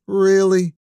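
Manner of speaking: high, exasperated disbelief; exasperated really. Acted. Adam to cop after waiting an hour, exasperated disbelief. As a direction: throw up your arms in disbelief.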